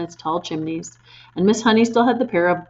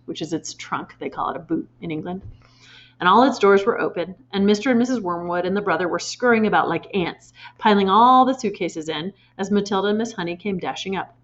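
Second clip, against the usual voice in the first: ordinary delivery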